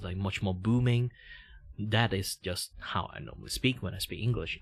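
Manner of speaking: normal talking voice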